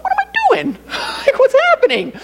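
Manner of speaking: laughing at yourself while tearing up. Real, not acted. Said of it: "I'm starting to tear up".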